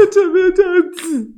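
high slow whimpering sob; mock sobbing demo. Shown as a demonstration. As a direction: act out a sniffling cry. (模仿抽泣)

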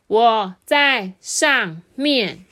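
very slow, loud, word by word; drawn-out beetle boy's call; calling from above. Acted. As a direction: call down with every syllable separated. Boy calls from ceiling.

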